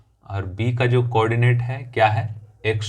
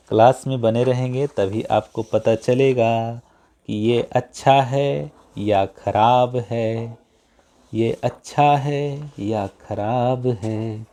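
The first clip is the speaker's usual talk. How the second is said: slow, stretched-out words at usual pitch; drawn-out delivery; slower than usual. Real, not acted. Teacher urging students to stay in class, slow and drawn-out. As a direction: draw each phrase out slowly.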